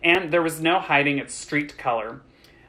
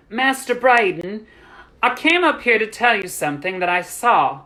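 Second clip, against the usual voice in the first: higher, earnest nervous address; 6 semitones higher, 4 dB louder than usual. A narrator's reading. Servant girl gathers courage to tell young master, nervous. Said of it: "Gathering her courage ... nervously".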